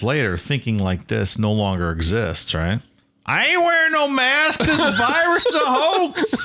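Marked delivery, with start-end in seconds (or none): 3.2-6.5 mock Southern anti-masker. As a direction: play a ranting anti-mask Southerner in an overblown Southern accent. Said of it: "in the southern thing about covid".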